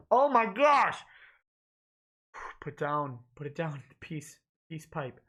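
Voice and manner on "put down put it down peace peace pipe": mutter. Real, not acted. Muttered aside, much softer.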